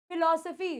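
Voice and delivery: फिलॉसफी with both फ sounds as f; फिलॉसफी, lips touching on फ. Shown as a demonstration. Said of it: "फिर पीएच वाला आ रहा है".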